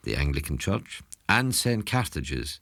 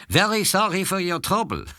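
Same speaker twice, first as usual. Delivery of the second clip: put-on a lilting Waterford accent, pitched much higher; 10 semitones higher than usual. A tour guide mimicking how Waterford people speak, in a Waterford accent. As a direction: imitate a Waterford local offering condolences in their accent. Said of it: "that's a real French accent".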